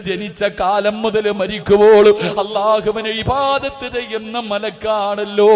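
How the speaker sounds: loud, chanting sermon cry with long-drawn endings; impassioned preaching. Acted. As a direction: preach at full force, stretching the last word of each phrase. Preaches at full force. (ഉച്ചത്തിൽ ഈണത്തിലുള്ള വികാരഭരിത പ്രസംഗം)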